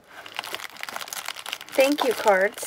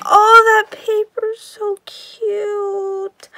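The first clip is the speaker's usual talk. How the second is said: high, teary cry of delight, slow; nearly crying with joy; real joyful whimper, high and drawn out; excited cry; 9 semitones higher, 8 dB louder, slower than usual. Real, not acted. Thrilled by the paper.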